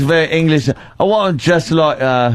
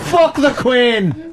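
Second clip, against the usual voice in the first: mock Irish brogue, higher, laughing; 8 semitones higher, slower than usual.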